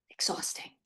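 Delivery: "Exhausting" whispered, no voice; whispered echo. Real, not acted. Coach sighs to parents, whispering "Exhausting". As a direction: breathe "Exhausting" as a tired aside. Echoes the word under her breath.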